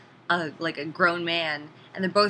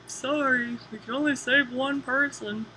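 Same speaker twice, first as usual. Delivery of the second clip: higher, slow, sing-song fake sorry; mock dismissive apology; 7 semitones higher, 4 dB quieter, slower than usual. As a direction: shrug him off with a breezy excuse. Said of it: "And everyone's like".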